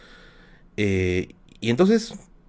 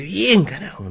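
grito contenido de festejo; 5 semitones higher, slower than usual. Retold or imitated. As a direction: act out a triumphant yell, held back. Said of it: "Grité". (grito de triunfo imitado, sin fuerza)

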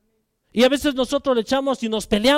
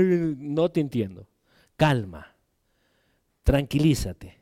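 low, measured reassurance; calm grandfather; 8 semitones lower, 3 dB quieter than usual. Retold or imitated. Grandfather calming his crying grandson, low and patient. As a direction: soothe the child in a low voice.

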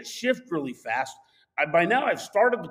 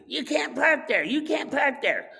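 higher-pitched mock yell; retold yelling; 8 semitones higher than usual. Irate stranger to the driver pulling in, retold yelling. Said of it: "somebody yelling at me".

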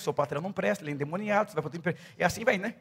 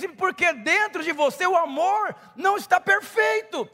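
loud, high fervent sermon delivery; full-force preaching; 10 semitones higher, 9 dB louder than usual. Acted. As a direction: preach it out to the crowd with fire.

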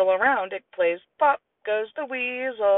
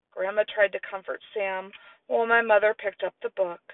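usual speaking voice

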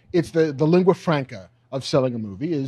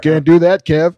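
mock refusal; 3 semitones higher, 10 dB louder than usual. Playing John on phone.

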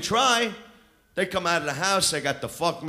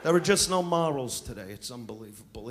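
usual speaking voice

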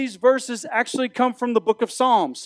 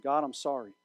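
quiet confession; 6 semitones lower, 8 dB quieter than usual. Acted. Models a confession prayer.

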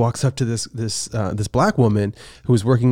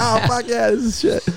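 excited bro; 12 semitones higher than usual. Retold or imitated. Friend voiced flipping burgers.

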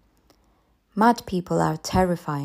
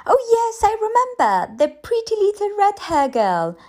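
put-on much higher, chirpy young teacher; cheerful and chatty, unaware of anything wrong; teacher gushing brightly to the mother; 7 semitones higher than usual. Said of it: "then said brightly".

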